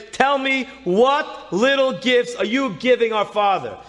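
high, slow, emphatic demand; urgent demand. Retold or imitated. Sister waking her sister about a dream, urgent demand. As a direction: press each word home, pitched high.

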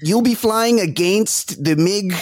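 exaggerated Canadian accent, high; mock Canadian drill instructor. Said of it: "how just openly Canadian Michael Ironside sounds".

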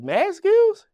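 high, loud incredulous question; mock-amazed exclamation; astonished reaction. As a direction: imitate his disbelief, pitch shooting way up. Retold astonished reaction to a name.

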